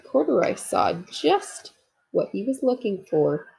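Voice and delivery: ordinary delivery, nothing marked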